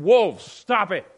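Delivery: slow, loud and stern command; slow loud warning shout; shepherd's shout. Pretend. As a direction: bark the wolves away.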